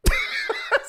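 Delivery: breathy burst of laughter. Real, not acted. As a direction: crack up at the punchline. Laughs at own story.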